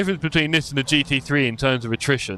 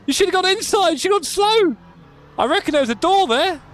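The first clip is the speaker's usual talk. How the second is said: excited shouting; 14 semitones higher than usual. Real, not acted. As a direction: yell at the screen as the move fails. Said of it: "Maybe I'm a bit excited".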